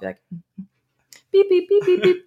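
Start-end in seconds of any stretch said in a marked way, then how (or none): 1.3-2.3 high, loud keypad beeps; imitated dialling beeps. Child nervously dialling a friend's house, high imitated beeps. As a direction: beep out each digit high and even.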